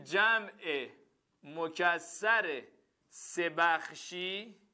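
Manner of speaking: very slow, halting dictation; teacher dictating a definition. Shown as a demonstration. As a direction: spell the rule out word by word.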